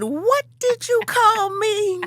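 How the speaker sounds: high, slow, indignant challenge; outraged retort. Retold or imitated. Retelling how she confronted the insulting woman, slow high outrage. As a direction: stretch it out in high disbelief.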